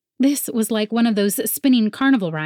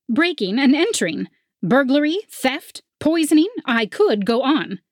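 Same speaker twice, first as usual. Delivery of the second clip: mocking officer; 7 semitones higher than usual. A narrator's reading. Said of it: "let out a bark of a laugh".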